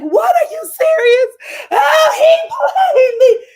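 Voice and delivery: high, loud crying shout; mock shocked crying. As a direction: wail it out betrayed. [name] shocked then crying.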